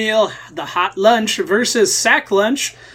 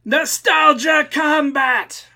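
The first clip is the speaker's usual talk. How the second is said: slow, drawn-out announcer shout, pitched higher; 7 semitones higher, much slower than usual. Acted. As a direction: boom the segment title like an arena announcer. Announcing the show segment title.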